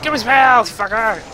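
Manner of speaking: high, loud shout with a curse; frustrated shout. Real, not acted. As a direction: scream at the game in real anger. Begging game for health.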